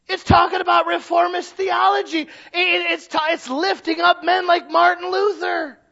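high, loud preacher's shout; full-force sermon shout building; shouted preaching. Acted. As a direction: hammer it home from the pulpit.

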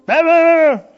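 shrill little brothers fighting, mock; bickering kids. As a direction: mimic two children arguing in gibberish.

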